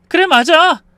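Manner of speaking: high, forceful outburst; bitter agreement. A narrator's reading. Wife throwing husband's insult back at him, bitter. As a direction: concede it loudly, bitterly.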